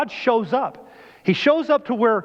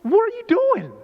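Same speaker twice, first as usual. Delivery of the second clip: God questioning; 9 semitones higher than usual. Pretend. God confronts a wanderer, high incredulous questioning. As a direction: ask it in disbelief, pitched way up. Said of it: "God looking at you and said".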